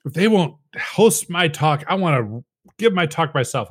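a hint of a pouting wife. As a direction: play the sulky wife. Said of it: "she was pouting about something".

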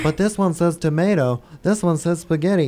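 lightly voiced whining child; childish whine. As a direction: mimic a fussy kid. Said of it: "you're thinking like a child".

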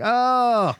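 high, drawn-out melting oh; mock-weepy and overcome; mock tearful aww. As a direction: pitch it way up as if touched to tears. Reacts tearfully to a touching scene.

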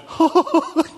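a short high laugh; high mock laugh. To the admirer, a short high awkward laugh.

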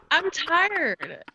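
ordinary talk

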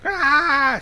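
high, loud mock scream; imitated pain holler. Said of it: "She hollers".

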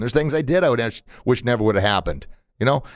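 ordinary talk

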